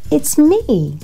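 put-on high, insistent little-girl outburst; mild yell. A narrator's reading. Patricia stamping her foot to be recognised, mock yelling. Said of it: "yelled Patricia, stamping her foot".

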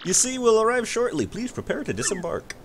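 gently waking the passenger; polite sailor. Acted. Voices the ship sailor.

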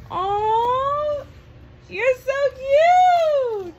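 high, loud squeal of delight; excited squeal. Real, not acted. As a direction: gush over how cute it is. Gushing over something cute.

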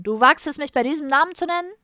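loud, high, indignant outburst; enraged ruler yelling at an offender; furious shouting. A narrator's reading. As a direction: shout it, rising in pitch with outrage. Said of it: "schrie Thyrson".

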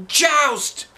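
high, loud starting call; yelled. Acted. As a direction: shout the start of the joust. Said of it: "yelled Neil".

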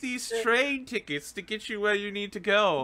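high, theatrical character voice; Arden. Retold or imitated. Host imitating a game villain offering train tickets, high and theatrical. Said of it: "he was really good as Arden".

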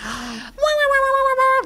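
high squeaky tape whir; fast forward sound. Pretend. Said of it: "I'm gonna do the Jan Hooks fast forward sound".

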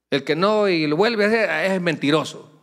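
fervent preacher shout; loud preaching. Real, not acted. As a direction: drive the point home to the congregation. Preaching emphatically.

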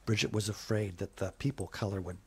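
plain reading voice

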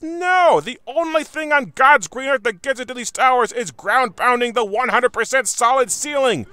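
yelled NO, then high and loud fury; shouting at a ridiculous game design; frustrated rant. Real, not acted. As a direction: blow up at the game. Ranting about the unguessable tower solution.

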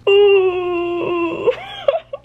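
loud, high squealed whine; high swooning wail. Real, not acted. Reader hears him ask her to stay, wailing. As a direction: wail as if your heart melts. Melting at a romantic line.

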